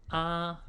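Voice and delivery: ah held high and level, first tone; 第一声的啊. Shown as a demonstration. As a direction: sing ah flat and high. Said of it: "the first tone".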